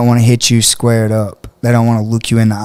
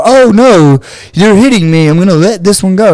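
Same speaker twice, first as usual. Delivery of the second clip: mock wimpy victim; 7 semitones higher than usual. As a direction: ham up a scared softie begging off. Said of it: "I'm not gonna go".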